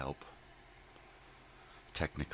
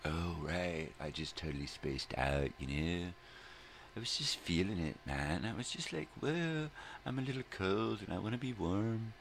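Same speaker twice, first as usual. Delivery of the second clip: spaced-out hippie, pitch drifting higher; 5 semitones higher than usual. Pretend. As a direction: put on a mock stoner hippie. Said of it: "Stop talking like a hippie".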